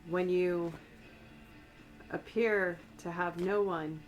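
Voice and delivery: ordinary talk, nothing marked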